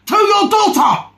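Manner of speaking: high, loud, slow shout of disbelief; outraged shout. Real, not acted. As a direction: bellow it in outrage. Outraged at a remark to daughter.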